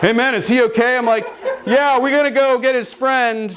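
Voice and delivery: nervous high voice. Retold or imitated.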